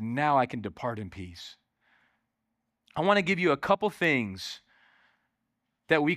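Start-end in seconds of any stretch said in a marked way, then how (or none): 0.0-1.6 quiet peaceful Simeon. Retold or imitated. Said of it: "He said".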